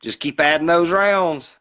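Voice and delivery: a hammed-up corner-man shout; trainer yelling. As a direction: yell it from the corner to the fighter. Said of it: "he goes".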